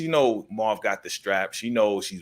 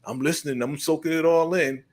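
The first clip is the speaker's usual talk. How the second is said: higher-pitched imitation of rapt listening; wide-eyed eager listener; 5 semitones higher than usual. Rock overhears Cartier bragging, mock wide-eyed fascination. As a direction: voice her eager attention, pitched up. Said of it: "listening with her eyes all big".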